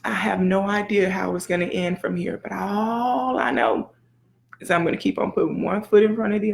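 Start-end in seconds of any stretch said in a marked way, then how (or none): none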